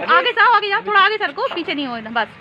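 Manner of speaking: loud, high urging to a climber; calling out instructions. Real, not acted. Directing climbing child on roof.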